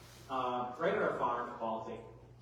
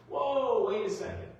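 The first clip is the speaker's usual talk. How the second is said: loud, higher "hold on" interjection; halting objection; 6 semitones higher, 6 dB louder than usual. Acted. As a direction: cut in and pull them up short. Interrupts the imagined farmer's advice.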